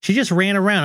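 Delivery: usual speaking voice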